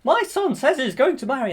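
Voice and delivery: high, loud pompous king voice; jolly king. Retold or imitated. King tells Stefan about his son, high and loud. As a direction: puff it up like a jolly old king. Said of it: "and then it was like".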